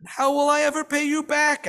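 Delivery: a sick widow half-groaning the question; a touch of a groan. A narrator's reading. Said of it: "asks the poor woman, groaning".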